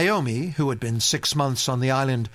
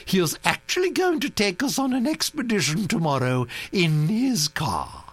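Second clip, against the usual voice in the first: high, commanding grand-lady voice; a bossy old aristocrat laying down plans; imperious old Duchess; 10 semitones higher than usual. A narrator's reading. Duchess beckoned authoritatively.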